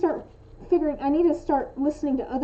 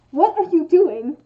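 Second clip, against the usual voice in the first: loud, mock-angry question; accusing; 3 semitones higher, 9 dB louder than usual. Shown as a demonstration. Showing how the full question implies anger, accusing. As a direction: demand it, every word separate. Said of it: "if you're saying it like".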